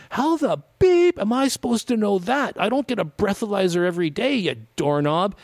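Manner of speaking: high, exasperated mock rant, easing down; mock indignant. Driver's unspoken retort to snarky cop, mock indignation. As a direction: act out an outraged comeback.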